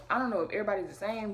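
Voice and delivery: ordinary talk, nothing marked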